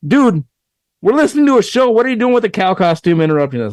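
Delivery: high, quick imitated complaint; mock indignation. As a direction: play an annoyed audience member protesting. Acting out annoyed audience's reaction.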